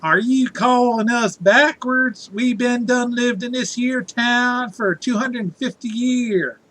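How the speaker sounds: exaggerated Southern yokel voice; Alabama accent. [name], you sound so Alabama.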